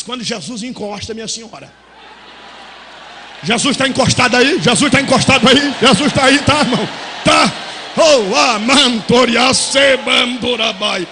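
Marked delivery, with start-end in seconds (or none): 3.4-7.5 loud, rising calls to the church; preacher working up the congregation; preaching loud. Acted. Preacher calling the crowd. 8.0-11.1 low, slow, loud tongues; loud ecstatic glossolalia in the pulpit; speaking in tongues. Acted.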